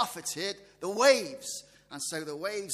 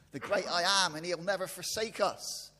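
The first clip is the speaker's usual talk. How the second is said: usual speaking voice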